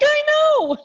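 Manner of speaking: high, loud gleeful squeal; giddy high-pitched agreement; proud squeal. Real, not acted. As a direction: own it with a delighted squeal. Friend notices she has changed.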